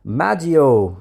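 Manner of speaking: put-on lightly Italian-accented name, called loud. A narrator's reading. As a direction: raise the voice with a touch of Italian accent. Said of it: "said in an Italian accent".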